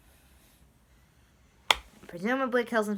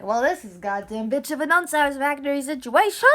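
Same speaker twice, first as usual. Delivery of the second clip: loud, raised, building exasperation; mock outraged rant; 6 semitones higher, 8 dB louder than usual. Lilith stabbed by antlers, complaining in mock outrage. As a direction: rant it with hammed-up indignation. Acting Lilith's angry outburst.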